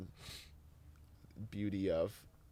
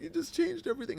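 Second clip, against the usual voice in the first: mock tearful; 7 dB louder, faster than usual. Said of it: "And they, like".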